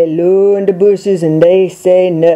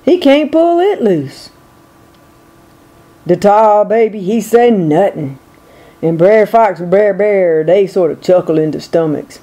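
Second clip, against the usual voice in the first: ordinary delivery, nothing marked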